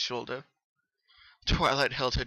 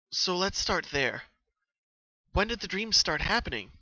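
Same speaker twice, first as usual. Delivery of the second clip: put-on higher, louder studious young mare; Twilight, studious; 5 semitones higher, 3 dB louder than usual. Twilight questioning Pinky about her dreams, studious. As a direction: ask it like a curious scholar.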